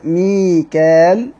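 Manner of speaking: ميكال said slowly as mi-kal on the mif'al pattern; ميكال stretched slowly, no hamza; mikal form. Shown as a demonstration. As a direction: draw out ميكال, long a, no hamza. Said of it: "قرئ بوزن مفعال".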